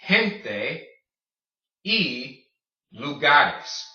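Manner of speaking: gente with an H sound, y said like E, lugares; Spanish gente y lugares. Shown as a demonstration. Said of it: "your J makes the H sound".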